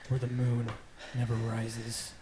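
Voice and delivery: normal talking voice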